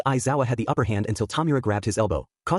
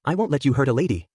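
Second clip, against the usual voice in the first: ordinary delivery, nothing marked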